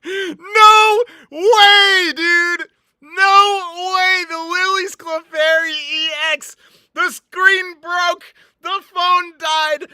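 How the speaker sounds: high, loud screams of disbelief; ecstatic screaming. Real, not acted. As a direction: shout in wild joy at the rare pull. Pulls rare card, screams with joy.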